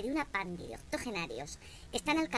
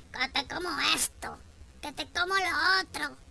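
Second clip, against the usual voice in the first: rising, louder mock catcall, "que te como"; leering village catcaller; 5 semitones higher, 4 dB louder than usual. Comedian imitating village men catcalling a girl, rising leer. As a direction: ham up a crude village man calling out.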